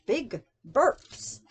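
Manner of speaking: usual speaking voice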